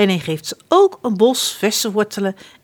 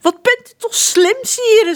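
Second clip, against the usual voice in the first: high, loud fawning flattery; Sir Hiss; 13 semitones higher, 7 dB louder than usual. A narrator's reading. Sir Hiss flattering prince John, high and loud. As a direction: gush over the prince like a sycophant.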